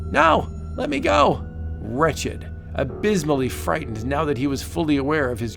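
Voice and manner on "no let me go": high frantic cry; frantic plea. Acted. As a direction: scream it out in panic. Dragged away.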